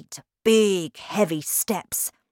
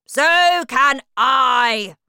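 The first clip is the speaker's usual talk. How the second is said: loud, higher, very slow sneering bark; scornful shout; 8 semitones higher, 11 dB louder, much slower than usual. A narrator's reading. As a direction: drag the words out in contempt. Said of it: "shouted Miss Trunchbull".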